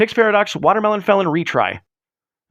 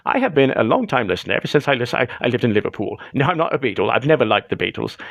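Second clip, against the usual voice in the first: a mock old Londoner calling a radio show; Michael Caine British accent; 6 semitones lower than usual. Prank caller doing old man British accent. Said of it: "very good old man British accent that sounds like Michael Caine".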